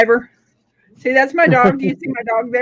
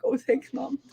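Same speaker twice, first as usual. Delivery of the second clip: a light, soft, higher voice for the dog; lightly voiced happy dog thanking Mom; 6 semitones higher, 6 dB quieter than usual. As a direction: play the grateful dog getting a back scratch.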